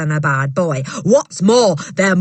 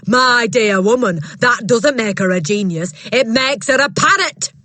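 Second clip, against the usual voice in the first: loud, higher, contemptuous bully; scornful headmistress; 6 semitones higher, 6 dB louder than usual. Acted. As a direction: talk down to the woman. Trunchbull voiced in story.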